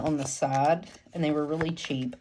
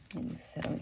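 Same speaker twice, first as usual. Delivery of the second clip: whispered under her breath; whispering; 2 semitones higher, 9 dB quieter than usual. Real, not acted. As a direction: whisper the question to yourself. Testing the insert, muttering to herself.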